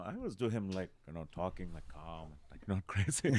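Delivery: soft, quiet, calm voice; understated calm Robin Williams. Shown as a demonstration. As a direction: talk gently like the genuine man. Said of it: "I always like doing that impression".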